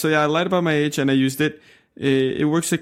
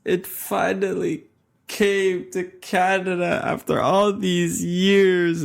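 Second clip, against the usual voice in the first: drawn out, higher-pitched mock relief; hammed-up long-awaited announcement; mock dramatic; 5 semitones higher, much slower than usual. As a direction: stretch it out as if after an endless wait. Mock-dramatic answer to the prompt.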